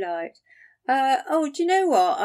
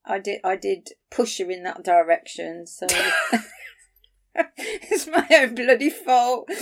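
ordinary talk

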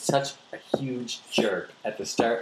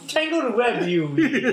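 laughing; 13 semitones higher, 4 dB louder than usual. Real, not acted. Laughing, high playful quote.

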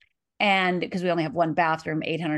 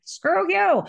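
defiant retort; 12 semitones higher, 3 dB louder than usual. Pretend. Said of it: "I never was like".

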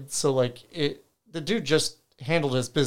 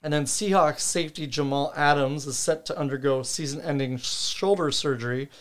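ordinary talk

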